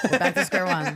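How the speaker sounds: laughing through "We're back to square one". Real, not acted.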